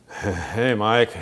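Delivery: greeting in a chuckling stoner's voice; imitated stoner classmate. Classmate greets him in an empty hallway, imitated stoner voice. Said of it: "he's one of these guys that he'd start going".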